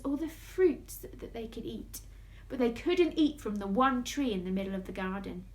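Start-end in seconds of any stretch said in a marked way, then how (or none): none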